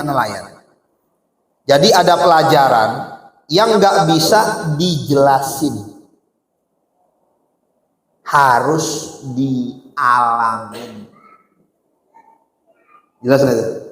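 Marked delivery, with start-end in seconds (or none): 3.5-11.1 very slow, drawn-out point; slow emphasis. Real, not acted. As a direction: stretch each word to make it sink in. Drives home the lesson slowly.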